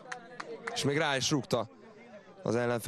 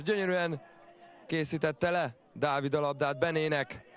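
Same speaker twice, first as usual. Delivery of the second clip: usual speaking voice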